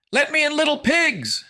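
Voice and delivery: high, loud fiendish screech; shrieking demon voice; demonic bellow. A narrator's reading. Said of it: "a demonic voice bellowed".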